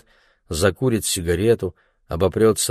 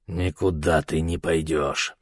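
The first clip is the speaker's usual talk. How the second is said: put-on slow, lower, slurring English drunk; town drunk; 4 semitones lower, 4 dB quieter than usual. A narrator's reading. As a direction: slur it like a drunk. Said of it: "заплетающимся языком проговорил англичанин".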